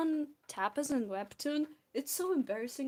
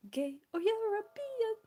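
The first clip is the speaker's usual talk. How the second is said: musical quote; 10 semitones higher than usual. Pretend. Theater kid quoting Legally Blonde to chat, hammed-up. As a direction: belt it like a Broadway number.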